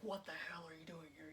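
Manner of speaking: hushed resentful demand. Retold or imitated. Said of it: "he has real resentment in his voice".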